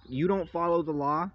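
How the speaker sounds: ordinary delivery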